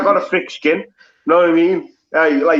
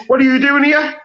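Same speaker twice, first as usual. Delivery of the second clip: much higher-pitched imitated question; mock questioning; 9 semitones higher than usual. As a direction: play someone questioning an outsider, pitched high. Acting out people questioning a Scouser.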